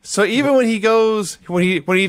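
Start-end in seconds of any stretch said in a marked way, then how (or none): none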